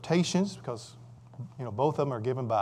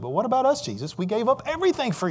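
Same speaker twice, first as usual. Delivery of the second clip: Peter pleading; 8 semitones higher, faster than usual. Acted. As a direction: plead like a disciple who gave up all.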